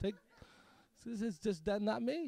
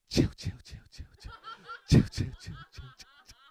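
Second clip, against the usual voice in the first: hushing whisper. Pretend. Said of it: "He'd be like".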